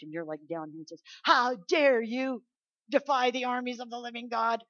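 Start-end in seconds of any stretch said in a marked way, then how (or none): 1.2-4.6 shouted challenge. Retold or imitated. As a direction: roar it at the giant like young David. David shouting at Goliath.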